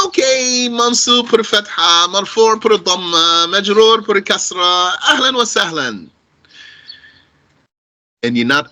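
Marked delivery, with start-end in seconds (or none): 0.1-6.1 high-pitched parroting of grammar rules; mimicking rote students. Teacher imitates students reciting case endings without understanding, mock rote recital. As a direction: rattle it off high, like a memorised routine. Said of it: "you've just been saying".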